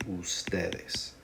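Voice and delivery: whispered. Shown as a demonstration. Teacher naming plural form.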